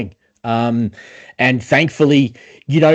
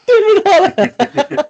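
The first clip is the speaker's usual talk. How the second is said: laughing; 4 dB louder than usual. Real, not acted. Laughing at the Highlander mix-up.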